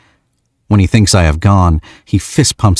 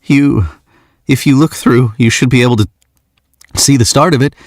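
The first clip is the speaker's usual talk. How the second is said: higher, nervous voice that cracks; voice cracking; 7 semitones higher than usual. Acted. Nervous young man shows her the telescope, voice cracking. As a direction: let the voice break with nerves. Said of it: "his voice cracking as he speaks".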